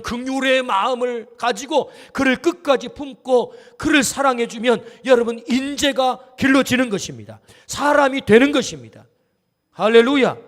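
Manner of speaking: rousing pulpit preaching; preaching at full force. Acted. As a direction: climb to a shouted hallelujah. Preacher rises to climax.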